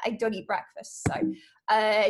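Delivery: usual speaking voice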